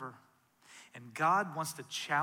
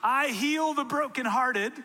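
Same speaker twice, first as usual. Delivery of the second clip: higher, louder, driving sermon peak; full-force preaching; 6 semitones higher, 7 dB louder than usual. Acted. Pastor to the discouraged congregation, preaching at full force. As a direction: preach it out to the whole room, rising and loud.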